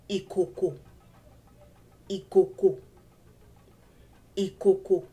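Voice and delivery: "ikoko" with all three syllables low; all low tones. Shown as a demonstration. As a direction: keep every syllable of "ikoko" down on do. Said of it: "all three syllables have the do".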